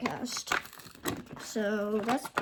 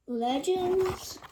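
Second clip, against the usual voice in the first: ordinary talk, nothing marked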